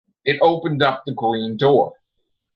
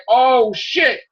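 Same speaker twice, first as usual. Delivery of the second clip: shocked; 9 semitones higher than usual. Retold or imitated. Realizing who the foe is.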